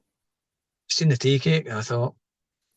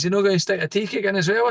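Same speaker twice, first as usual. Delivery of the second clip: higher, mock-cheeky girl from the parlour; imitating funeral parlour girl; 8 semitones higher than usual. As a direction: do her joking question, pitched up. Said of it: "She says".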